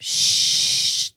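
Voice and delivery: ordinary talk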